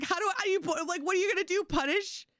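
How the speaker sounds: a bemused principal who can't believe it; high mock disbelief; incredulous principal. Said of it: "He's like".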